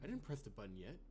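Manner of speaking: soft, low, uneasy in-character reading; game protagonist voice. Acted. Player voicing the heroine exploring a frozen floor, soft and uneasy. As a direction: voice the wary hero exploring the floor.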